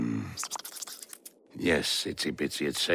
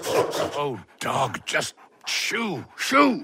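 drawn-out shoo, then a high loud shoo; slow shooing; 12 semitones higher, 6 dB louder, much slower than usual. Acted. Rock shooing the dog, drawn-out then loud.

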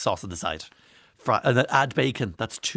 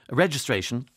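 ordinary delivery

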